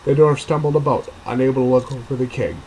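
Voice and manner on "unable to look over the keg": ordinary delivery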